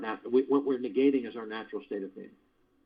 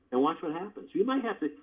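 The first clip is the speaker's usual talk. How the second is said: ordinary talk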